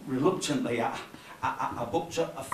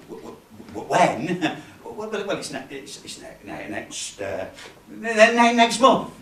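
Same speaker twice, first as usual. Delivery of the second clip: panicked stammering; 3 semitones higher than usual. Acted. Newcomer invents a date for his gig, panicked stammer. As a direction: stumble over it, then blurt it out.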